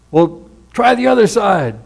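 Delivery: offhand tip from the shore; lightly voiced helpful stranger; casual suggestion. Stranger advises the fishermen.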